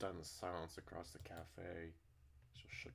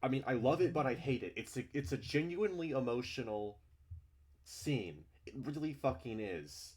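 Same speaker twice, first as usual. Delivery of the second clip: loud, heated complaint; genuinely upset, venting to the audience; upset rant; 7 semitones higher, 10 dB louder than usual. Real, not acted. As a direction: rant about how much it hurts to read. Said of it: "I don't usually get upset".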